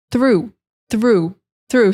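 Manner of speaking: through said slowly with hard TH; teacher drills through again; strong th. Shown as a demonstration. Teacher repeating through carefully. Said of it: "strong T H sound".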